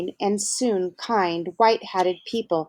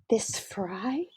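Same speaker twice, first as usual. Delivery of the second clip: whispered question; 3 semitones higher, 8 dB quieter than usual. Acted. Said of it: "unvoiced whisper".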